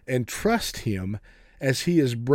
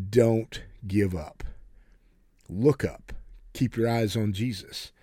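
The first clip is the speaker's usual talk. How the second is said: slow exhortation; 4 semitones lower, slower than usual. Acted. Preacher exhorting his listeners.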